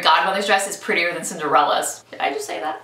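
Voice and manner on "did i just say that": higher, softer, caught herself out; mock scandalised aside; surprised at herself. Real, not acted. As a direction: gasp at your own words, half-laughing. Shocked at her own opinion.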